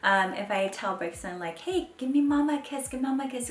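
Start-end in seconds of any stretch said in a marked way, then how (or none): 1.6-3.5 high-pitched sweet mommy voice; coaxing baby talk. Retold or imitated. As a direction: coax a toddler for a kiss. Mom coaxing toddler for a kiss.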